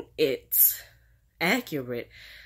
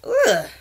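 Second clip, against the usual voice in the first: disgusted ugh; 8 semitones higher, 8 dB louder than usual. Real, not acted. Reacting to Zelda's accent.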